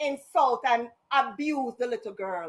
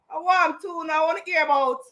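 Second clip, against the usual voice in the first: normal talking voice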